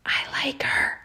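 whispered aside; mouthed. A narrator's reading. Bilius mouthing to Oscar behind Sally, whispered. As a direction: breathe it without voice. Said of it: "Bilius mouthed to Oscar".

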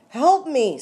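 high, loud plea; screamed. A narrator's reading. Rita pleads as the man with the knife appears, screaming. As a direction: scream out for rescue. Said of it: "screamed Rita".

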